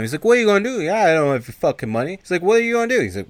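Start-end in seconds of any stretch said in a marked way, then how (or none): 0.2-2.2 high, loud mock defiance; mouthing back. Guy who owes money talks back, mock defiant. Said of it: "starts mouthing back at him". 2.4-3.0 high, loud mock defiance; mouthing back. Guy who owes money talks back, mock defiant. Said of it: "starts mouthing back at him".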